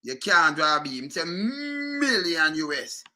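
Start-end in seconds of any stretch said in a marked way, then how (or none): 1.3-3.0 MILLION slow, on a long hummed M; drawn-out M. Shown as a demonstration. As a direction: hold the M of MILLION before opening it. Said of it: "you draw".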